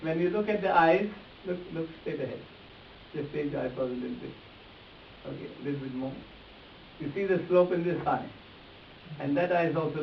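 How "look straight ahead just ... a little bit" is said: ordinary talk, nothing marked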